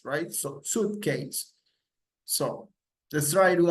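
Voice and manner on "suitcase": suitcase said slowly as "soot-case"; slow suitcase with plain "oo", no w; correct pronunciation. Shown as a demonstration. Teacher repeats the correction, slow careful "soot-case" for suitcase. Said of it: "not suitcase".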